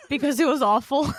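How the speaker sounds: cracking up mid-sentence; laughing. Real, not acted. Laughing while reading review.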